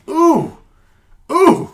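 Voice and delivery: two loud, high cringing oohs; squeamish ooh. Retold or imitated. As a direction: recoil aloud from body horror, twice. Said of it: "body horror moment in here where you're just like".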